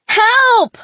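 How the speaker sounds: high, loud desperate scream for help; full-voiced, high-pitched scream. A narrator's reading. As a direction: scream out for rescue. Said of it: "I screamed".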